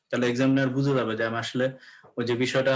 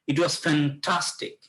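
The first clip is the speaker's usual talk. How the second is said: drawn-out, excited FANTASTIC; enthusiastic; 3 semitones higher, much slower than usual. Shown as a demonstration. Teacher showing students expressive language, enthusiastic. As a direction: stretch "fantastic" slowly with expressive emphasis. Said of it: "তুমি এটা বিষয়টা হচ্ছে যে একটু ইয়া করে বলতেছো, এটা একটু এক্সাইটিং".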